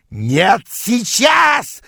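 very high shout on the last word; wild yell. A narrator's reading. Rival rushing in pursuit, yelling. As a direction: scream the refusal, leaping up in pitch. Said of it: "завопил он".

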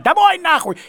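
громкий крик, выше обычного; заорать «Домой, нахуй!». Retold or imitated. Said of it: "первый я, конечно, закричал". (громко кричит от отчаяния)

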